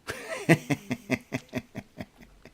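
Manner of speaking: cackling evil villain laugh. Pretend. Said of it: "That's, that's my evil villain laugh".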